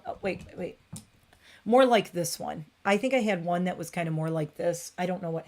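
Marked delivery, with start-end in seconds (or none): 0.0-0.8 soft breathy mutter; muttered aside. Real, not acted. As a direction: mutter it to yourself.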